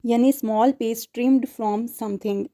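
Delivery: very slow, deliberate reading; teacher dictating a definition slowly. Shown as a demonstration. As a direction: spell out the meaning word by word. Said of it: "teacher gives definition".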